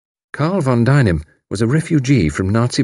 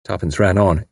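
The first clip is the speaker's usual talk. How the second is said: plain reading voice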